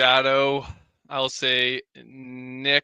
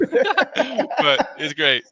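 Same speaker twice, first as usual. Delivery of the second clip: laughing; 11 semitones higher, 6 dB louder, much slower than usual. Real, not acted. Breaks up mid pirate bit.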